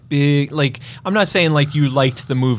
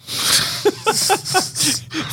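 high breathless wheeze of a laugh; squealing high laugh. Real, not acted. Cracking up at the Clifford joke, high breathless laugh. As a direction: laugh high and airless at his own joke.

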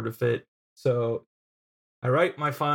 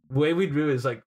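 ordinary talk

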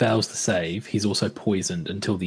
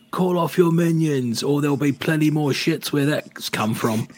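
loud, raised-pitch threat to an enemy; yelling out; 6 semitones higher, 4 dB louder than usual. Acted. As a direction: shout it out as an order. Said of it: "I'm gonna yell out".

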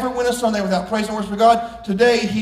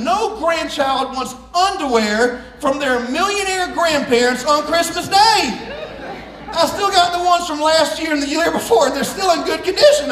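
comic rant; 5 semitones higher than usual. Real, not acted. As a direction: rant like a let-down grandkid. Comic rant about grandparents' gifts.

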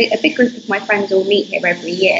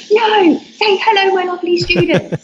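eager fan demand; 10 semitones higher than usual. Retold or imitated. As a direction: play the excited followers begging for it. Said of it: "I got a ton of messages saying".

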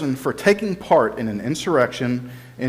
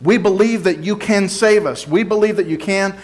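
mildly imploring, pitched higher; retold crowd plea; 6 semitones higher than usual. Crowd crying Hosanna to be saved, retold as a raised plea. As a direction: plead on the crowd's behalf, voice lifted. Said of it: "That's what they were shouting".

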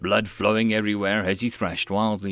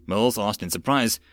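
plain reading voice

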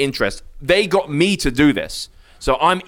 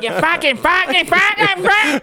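rising, ever higher furious cursing; angry Australian caller imitated, swearing higher and higher; mock rage. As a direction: climb in pitch with each curse. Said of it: "You're like".

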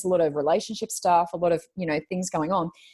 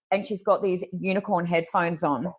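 ordinary talk

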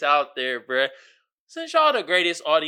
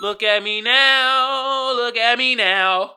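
proud gloat; 5 semitones higher, 6 dB louder, much slower than usual. Pretend. Gloating louder.

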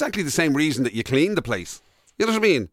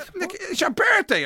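mock wife. Voicing wife continued.

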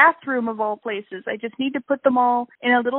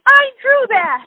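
slow, high proud declaration; proud exclaim; 12 semitones higher, 8 dB louder, slower than usual. Retold or imitated. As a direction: announce it with pride. Retold proud reply to mother.

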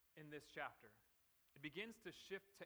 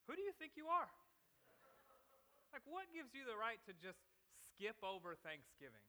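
mock outrage; 5 semitones higher than usual. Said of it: "that's my rant".

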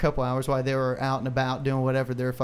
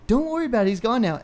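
higher, lightly voiced young girl; a quick girlish brush-off, pitched up; imitating the girl; 8 semitones higher, 3 dB louder, faster than usual. Quoting the girl as she says her dad is gone, higher.

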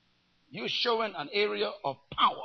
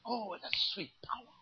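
breathy whisper of delight; a secret pleasure, whispered; whispered relish; 10 dB quieter than usual. Acted. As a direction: whisper it like tasting something sweet. Preacher relishing power.

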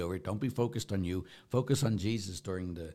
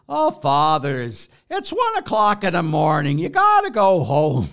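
high, loud mock barman calling time; mock pub owner; 8 semitones higher, 12 dB louder than usual. Priest mimics the pub owner sending the friars home, high and loud. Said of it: "and said to".

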